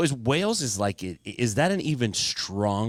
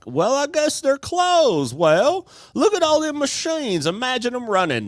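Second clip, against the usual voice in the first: Huel Hauser impression; 5 dB louder than usual. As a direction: marvel at closed machines like a goofy TV host. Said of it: "Huel Hauser".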